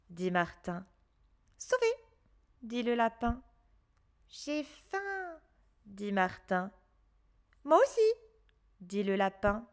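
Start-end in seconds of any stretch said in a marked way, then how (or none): 1.6-1.9 high rabbit voice, relieved; lightly voiced relieved rabbit. A narrator's reading. Rabbit voice in story. 4.3-5.4 high, much softer little voice; soft high boy's voice; hungry boy. A narrator's reading. Martin voice in story. 7.6-8.1 high, loud small rabbit voice; higher, louder rabbit voice, surprised; rabbit character. A narrator's reading. Rabbit voice in story.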